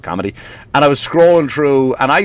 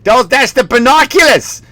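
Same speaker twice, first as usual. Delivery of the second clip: high, pitched-up Boston mother; Boston mom; 11 semitones higher, 4 dB louder than usual. Retold or imitated. Mom filming her son's Christmas present, high Boston voice. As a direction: imitate a Boston mom talking up a gift. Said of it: "the mom or the grandmother, whoever's videotaping".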